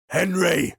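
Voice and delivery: loud, high raspy shout of a name; raspy call. Acted. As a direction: rasp out the name, hard-pressed. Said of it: "Thorn rasped out".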